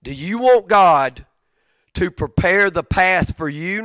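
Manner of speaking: slow pointed question to the congregation; slow emphatic question. Real, not acted. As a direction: lean hard into each word. Repeated question, slowed and louder.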